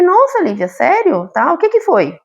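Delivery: mother feigning big surprise at her daughter's dream; mock surprise. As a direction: play up the amazement for her daughter.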